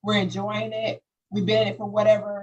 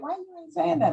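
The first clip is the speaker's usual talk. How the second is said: higher-pitched mock questioning; pitched-up quote of his question; retold questioning; 9 semitones higher, faster than usual. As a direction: imitate a puzzled partner, pitched up. Retold partner questioning her silence.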